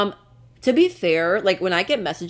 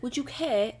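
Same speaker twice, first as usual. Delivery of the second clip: short mock British; British accent; 3 semitones higher, 7 dB quieter than usual. Shown as a demonstration. Trying again after being criticised, British accent. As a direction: try it in British, dropping the R. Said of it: "First one, British".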